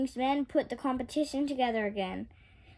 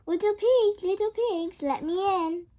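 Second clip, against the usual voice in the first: calling out; 7 semitones higher, 4 dB louder than usual. A narrator's reading. Red calls at the pigs' door.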